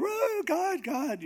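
very high, excited exclamation; amazed crowd cry. Retold or imitated. People reacting as the preacher leaves, high and amazed. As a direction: imitate an awestruck crowd, voice way up.

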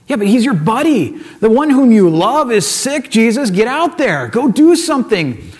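exasperated urging. Acted. Listener objects to Jesus staying put, exasperated urging. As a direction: urge a friend to act, stretching the key words.

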